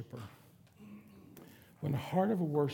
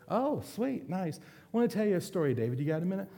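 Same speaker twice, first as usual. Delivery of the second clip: mock delight; 6 semitones higher than usual. [name] feigns delight, leads in.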